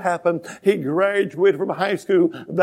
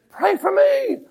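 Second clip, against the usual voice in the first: pleading cry; 5 dB louder, slower than usual. Retold or imitated. Congregant's plea acted out.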